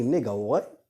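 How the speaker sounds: rising, disbelieving question; incredulous reaction. Acted. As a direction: blurt it out as a baffled question. Group reacts in stunned disbelief.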